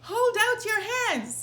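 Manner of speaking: high, loud shout. Acted. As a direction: bellow it so the house hears. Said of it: "he shouted".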